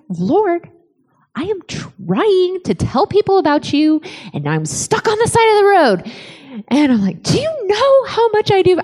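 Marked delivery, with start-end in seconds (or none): 0.1-6.1 high-pitched cranky complaint to God. Retold or imitated. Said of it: "I'm cranky". 7.2-8.8 high, indignant mock whine; cranky, like a jerk. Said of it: "being such a jerk".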